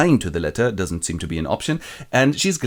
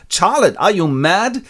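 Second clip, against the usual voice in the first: high, slow, loud outrage; shocked disbelief; 9 semitones higher, 5 dB louder than usual. Retold or imitated. Lizzie shocked at Charlotte, high and slow. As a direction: stretch it out in disbelief.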